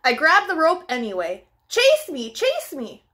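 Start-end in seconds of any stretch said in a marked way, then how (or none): 1.7-3.0 high, bouncy chase me; playful chase call. Acted. Dog inviting other dogs, high playful call. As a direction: tease them into chasing.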